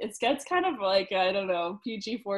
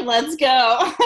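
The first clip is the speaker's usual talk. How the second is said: much louder, pumped call; excited go; 3 semitones higher, 12 dB louder than usual. Retold or imitated. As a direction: fire everyone up to leave. Ready to go out.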